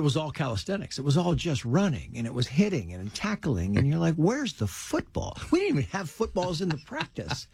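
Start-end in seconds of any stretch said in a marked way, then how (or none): 4.2-5.4 drawn-out, higher-pitched bewildered question; baffled complaint. Retold or imitated. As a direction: imitate a puzzled kid's complaint. Baffled kid complaining at practice.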